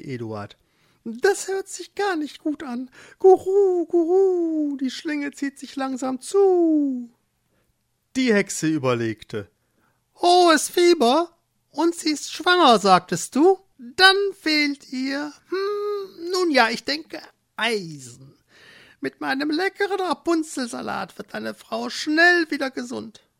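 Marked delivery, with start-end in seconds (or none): 1.0-7.1 hohes, lautes Guru Guru, aufgeregt; gurrende Tauben. Acted. 10.2-23.3 hohe, lauernd freundliche Hexenstimme; gönnerhaft wie eine listige alte Hexe; Kräuterhexe. Acted.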